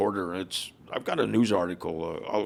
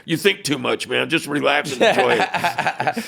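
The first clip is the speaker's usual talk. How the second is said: loud, higher retold voice of a colorful veteran; amused character; 6 semitones higher, 6 dB louder than usual. Veteran telling the young leader to relax, amused character voice. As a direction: imitate a character brushing off the worry, loudly. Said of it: "the guy was just a character".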